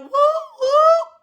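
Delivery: very high, loud whoop whoop; a joyful party holler; celebratory whoop. Real, not acted. Host cheers for her mama's dialysis center, high whoop.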